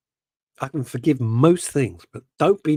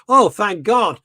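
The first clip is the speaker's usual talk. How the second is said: louder, higher mock relief; relieved exclaim; 8 semitones higher, 8 dB louder, slower than usual. At the end of the film, blurted relief. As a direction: blurt it like the ordeal is over. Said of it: "I went down".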